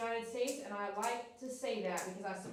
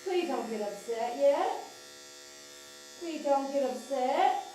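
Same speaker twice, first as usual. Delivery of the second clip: sing-song plea. Real, not acted. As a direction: coax the dog with a repeated sing-song plea. Soothing the dog with chanted plea.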